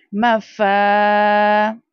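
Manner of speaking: मफा very slow, फा drawn long; stretched faa. Shown as a demonstration. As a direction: hold the फा. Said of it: "Again अलिफ मद्दा". (मफा में फा खींच कर)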